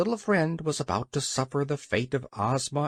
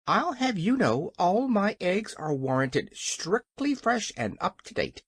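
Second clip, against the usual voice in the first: high, huffy hen voice; indignant; 6 semitones higher than usual. A narrator's reading. Hen defends her eggs to the King, indignant. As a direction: stand up for it, pitched high. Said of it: "said Billina indignantly".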